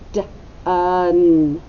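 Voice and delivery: D-un sounded apart, each part slowly. Shown as a demonstration. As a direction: split into sounds D and un. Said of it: "echo me".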